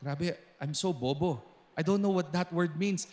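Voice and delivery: self-deprecating aside. Retold or imitated. Preacher retells his thought on checking the definition, self-deprecating. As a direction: confess your ignorance under your breath.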